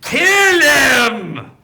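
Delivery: high, loud command; shouted order. Acted. NPC urging the party to kill him.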